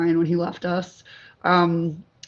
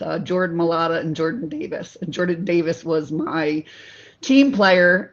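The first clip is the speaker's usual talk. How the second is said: usual speaking voice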